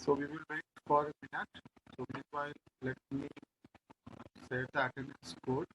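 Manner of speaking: very low, faint voice; too quiet to hear clearly; barely audible. Real, not acted. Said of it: "Sir, your voice is very low".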